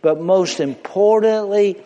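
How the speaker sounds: ordinary talk, nothing marked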